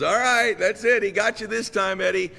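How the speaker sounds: Big Ray. Retold or imitated. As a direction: break up the fight as the other boy's dad. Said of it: "and his dad goes".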